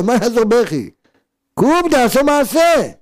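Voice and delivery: high, loud call to action; rally them out of their tears; rousing shout. Acted. Rousing the weepers to act.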